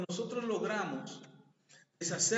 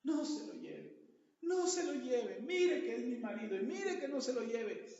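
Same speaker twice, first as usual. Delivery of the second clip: pleading wife; 5 semitones higher than usual. Retold or imitated. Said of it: "empieza a llorar la señora".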